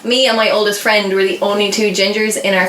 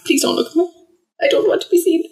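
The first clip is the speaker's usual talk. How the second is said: higher, small pleading voice; shy child plea; 10 semitones higher, faster than usual. Retold or imitated. Bullied ginger kid hiding, small higher plea. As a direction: beg not to be noticed. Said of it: "I was like".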